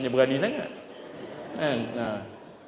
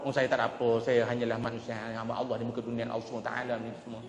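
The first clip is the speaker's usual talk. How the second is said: softer, lower modest murmur fading away; a soft, self-effacing humble man, lightly voiced; humble quiet voice; 6 semitones lower, 8 dB quieter, much faster than usual. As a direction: speak as a humble man brushing off praise. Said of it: "kalau sembang dengan dia".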